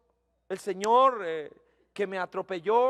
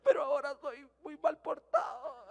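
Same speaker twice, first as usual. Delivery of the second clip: tearful confession; 5 semitones higher, 3 dB quieter than usual. Retold or imitated. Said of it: "Y empieza a llorar, pero de una manera dramática".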